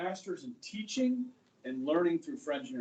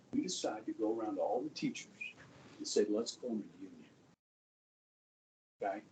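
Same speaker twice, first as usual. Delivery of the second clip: hushed, much softer secret plan; whispering; 8 semitones lower, 6 dB quieter than usual. Real, not acted. Teacher confiding union plan to class, hushed. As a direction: keep it low so nobody overhears. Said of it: "why am I whispering?".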